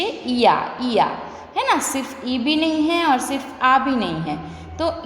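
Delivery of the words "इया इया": इया said alone as a sound, twice; demonstrated word ending. Shown as a demonstration. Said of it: "क्या हम pronounce कर रहे हैं".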